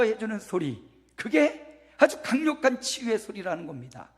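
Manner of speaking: forceful preaching. Real, not acted. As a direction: proclaim it emphatically to the congregation.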